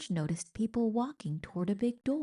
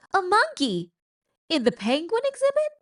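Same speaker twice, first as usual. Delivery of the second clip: high, loud shocked cry; alarmed aquarium worker shouting; shocked staff; 10 semitones higher, 6 dB louder, faster than usual. Acted. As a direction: gasp it out in disbelief. Staff shocked at monkey in exhibit.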